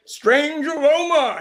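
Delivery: high, slow, hammed-up drawl; a six-year-old milking the cowboy tagline; proud little kid doing the commercial voice. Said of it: "And I go".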